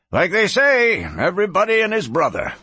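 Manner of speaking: a hint of a talkative, high-voiced barkeep; chatty saloon keeper. A narrator's reading. Saloon keeper to the sheriff about brisk trade, lightly voiced and chatty.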